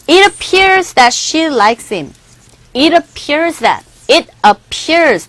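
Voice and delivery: very slow, careful English example; slow model sentence. Shown as a demonstration. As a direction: read it slowly for learners. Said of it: "첫 번째". (천천히 또박또박 읽기)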